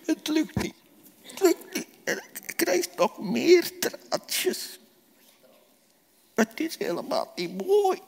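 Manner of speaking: put-on high, soft whimper of a little boy in despair; despairing child. Lucas despairs over the paint. (wanhopig kinderstemmetje, hoog en zacht)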